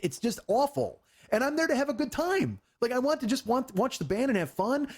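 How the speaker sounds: high-pitched, aggrieved rant; worked-up exasperation. Retold or imitated. Venting that he came for a good time, worked-up exasperation. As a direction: complain with pitch soaring. Worked up reliving the ruined night.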